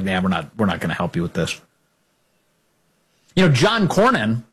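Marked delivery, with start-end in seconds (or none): 0.0-1.6 mocking senator voice. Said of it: "establishment Senate Republicans are like".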